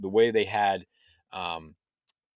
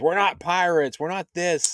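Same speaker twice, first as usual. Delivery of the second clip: higher-pitched imitated objection; mock protest; 10 semitones higher, 4 dB louder than usual. Fern, the grounded one, objecting to the others, high mock protest. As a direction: lightly act out an insistent kid objecting.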